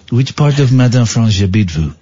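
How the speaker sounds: hammed-up French accent, halting; comic Frenchman fumbling his French. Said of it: "in my French accent".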